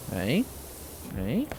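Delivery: ordinary talk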